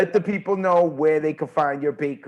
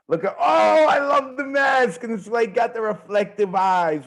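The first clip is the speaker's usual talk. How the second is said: high, loud delighted gush; excited; 8 semitones higher, 5 dB louder than usual. Real, not acted. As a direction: gush over the mask with delight. Delighted by a costume.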